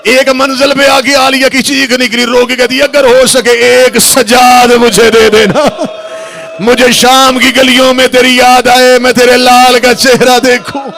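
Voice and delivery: impassioned mourning sermon at full force; full-force preaching. Acted. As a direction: preach it to the hall, voice climbing into a lament. Majlis recital rising to wail.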